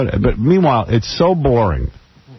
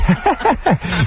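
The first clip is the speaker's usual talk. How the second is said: mock giggle; 8 semitones higher than usual. Mocking host laugh.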